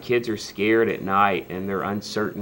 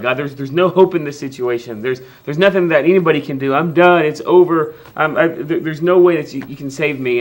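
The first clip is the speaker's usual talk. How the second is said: higher and much louder, hopeless outburst; despairing cry to God; 5 semitones higher, 9 dB louder than usual. Retold or imitated. Pastor acting out a believer crying out to God in despair, loud and higher. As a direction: wail it like you've given up. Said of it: "We're like".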